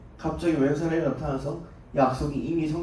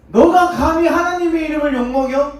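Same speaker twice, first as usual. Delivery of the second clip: outraged; 10 semitones higher, 7 dB louder than usual. Acted. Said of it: "이 사람들이 열받은 거예요".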